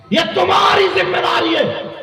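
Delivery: high shouted admonition; preacher's shout. Real, not acted. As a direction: hammer it out in a soaring preaching cry. Shouted repeat to the crowd.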